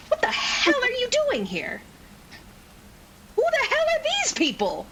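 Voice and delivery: very high, furious demand; screechy indignant questioning; outraged confrontation. Acted. Angry confrontation, acted character.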